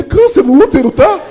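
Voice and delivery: put-on high, loud kid voice; boy telling the doctor; panicked kid. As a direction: announce it in a frightened kid shout.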